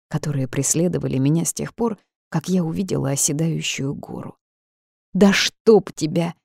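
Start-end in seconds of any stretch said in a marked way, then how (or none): 5.1-6.3 slow, drawn-out angry curse; wild cursing yell. A narrator's reading. Heroine striking the stuck iron spring, drawn-out angry yell. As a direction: curse it out, stretching each word. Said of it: "дико заорала".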